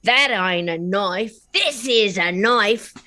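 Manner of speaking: slow, drawled Aussie movie line, lightly done; quoting Dundee in a slow Australian drawl; Crocodile Dundee quote. Retold or imitated. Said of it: "Crocodile Dundee came out".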